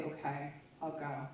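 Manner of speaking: barely-there surrender; resigned murmur, agreeing to go; resigned quiet. Retold or imitated. Resigned reply to forced hospitalization.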